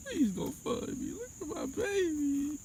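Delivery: begging viewers to fund a new car; mock begging. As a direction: beg like a pitiful child.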